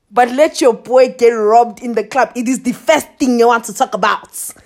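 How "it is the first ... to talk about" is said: ordinary talk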